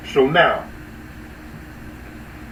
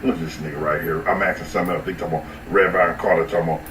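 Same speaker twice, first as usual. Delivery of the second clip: much lower, fast, mumbled put-on voice; Gene Deal impression; 10 semitones lower, much faster than usual. Streamer mocking Gene Deal's rambling, low rushed voice. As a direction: sink way down and rush it like Gene Deal. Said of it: "I'm doing Gene Deal right now".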